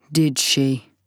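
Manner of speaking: a flat, cold question; toneless, giving nothing away. Acted. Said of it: "His voice is flat".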